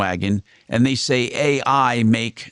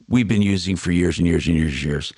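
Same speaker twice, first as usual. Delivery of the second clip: ordinary delivery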